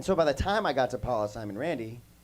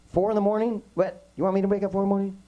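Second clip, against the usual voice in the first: higher and louder, fast disbelief at the hour; incredulous protest; 7 semitones higher, 3 dB louder, faster than usual. Retold or imitated. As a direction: object as if the idea is absurd. Retold shock at early call.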